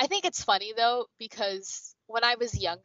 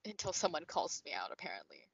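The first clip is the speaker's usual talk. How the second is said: whispered aside; 5 semitones lower, 11 dB quieter than usual. Real, not acted. Wry aside, trailing off.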